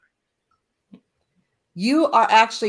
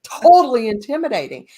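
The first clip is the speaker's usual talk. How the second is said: louder, mock-insistent friend; emphatic friends; 6 dB louder than usual. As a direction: tell her bluntly as an amused friend. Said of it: "They're like".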